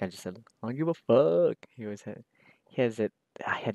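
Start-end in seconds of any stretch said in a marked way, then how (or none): 0.6-1.6 comedian catchphrase. Retold or imitated. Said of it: "He does, like, impressions".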